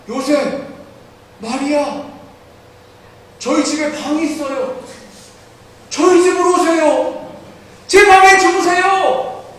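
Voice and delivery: high, rising to loud tender pleading; a boy inviting them in, heart breaking; tearful child. Retold or imitated. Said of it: "눈물을 막 흘립니다". (아이가 울먹이며 간절히 부르는 목소리)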